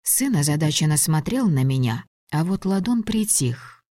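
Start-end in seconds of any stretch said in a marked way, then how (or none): none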